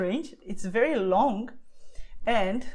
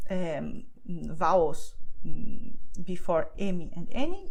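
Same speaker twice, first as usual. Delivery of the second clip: ordinary delivery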